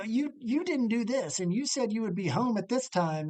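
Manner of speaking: mock the bickering of housemates. Roommate reenacts their petty married-couple squabbles, bickering. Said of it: "times where we would bicker".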